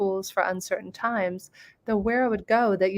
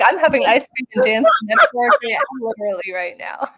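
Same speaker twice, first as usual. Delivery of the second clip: words bubbling with laughter, quick; laughing; 2 semitones higher, 6 dB louder, faster than usual. Real, not acted. Sharing her joy with the host, laughing. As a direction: laugh through the whole line. Laughing while quoting herself.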